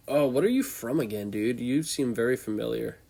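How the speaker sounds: ordinary talk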